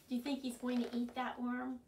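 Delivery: usual speaking voice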